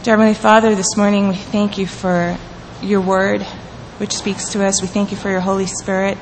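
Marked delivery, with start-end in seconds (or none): none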